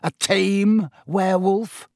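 building from cool interest to taunting emphasis; mocking Snape. A narrator's reading. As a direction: needle him with open contempt. Snape mocks Lupin with relish.

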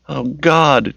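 high, anguished gasp of words. A narrator's reading. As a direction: squeeze it out in shock. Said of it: "Tracy managed".

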